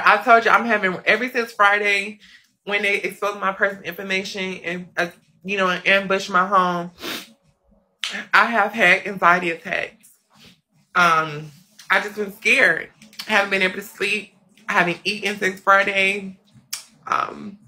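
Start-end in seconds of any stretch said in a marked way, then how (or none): none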